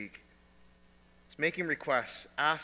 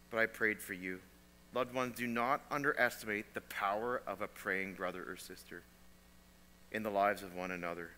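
soft tender appeal; 6 semitones lower, 5 dB quieter than usual. Real, not acted.

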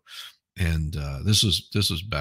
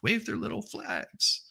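much higher, slow mocking lilt; mock them in a sing-song; 6 dB quieter than usual. Mocking the crowd in the news clip, high sing-song.

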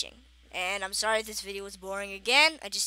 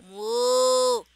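loud cow moo; 10 dB louder than usual. Pretend. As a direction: bellow a big moo, louder. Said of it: "Like, bigger".

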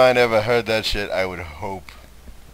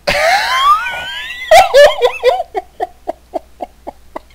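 very high, loud shrieking laugh; 8 dB louder than usual. Real, not acted. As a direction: let out a screaming laugh. Laughing at own bit.

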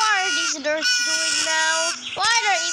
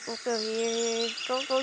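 normal talking voice

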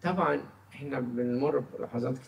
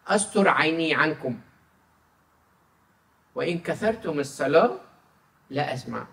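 solemn scripture reading; 4 semitones higher, 6 dB louder than usual. Acted. Reads Isaiah aloud in sermon. (قراءة كتابية مهيبة ببطء)

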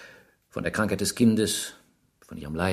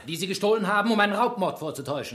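higher, hard accusing tone; 10 semitones higher, faster than usual. Acted. Inspector accuses the suspect of staging a robbery, raised voice. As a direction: pin him down with it.

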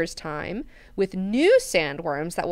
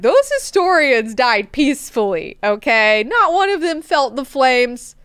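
defensive ruler; 6 semitones higher, 9 dB louder than usual. Retold or imitated. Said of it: "he's like".

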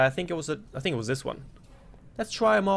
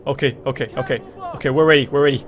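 normal talking voice

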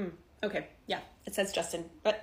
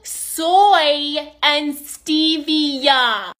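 very slow, loud, stretched syllables; abnormally over-enunciated; 7 dB louder, much slower than usual. Acted. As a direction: spell it out as if to an idiot. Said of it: "like a normal person".

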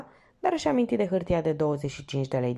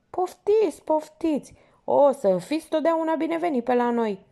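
high, loud, bowing and scraping; fawning porter; 11 semitones higher, 4 dB louder than usual. Acted. Porter fawning over the departing lieutenant, servile and loud. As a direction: overdo the servile welcome, up in pitch. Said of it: "ploconindu-se exagerat".

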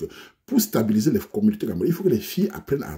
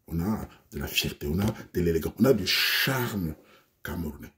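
dreamy homeland ode; 8 semitones lower, 7 dB quieter than usual. Acted. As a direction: savour each word like a love song to home. Man's wistful ode to Cameroon.

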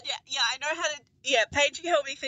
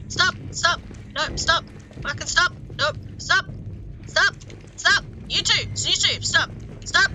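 loud, higher staccato shouts; shouted command; 5 semitones higher, 9 dB louder than usual. Real, not acted. Gamer desperately ordering things to stop, shouted command. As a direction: yell it over and over in short bursts.

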